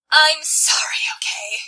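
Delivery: ordinary delivery, nothing marked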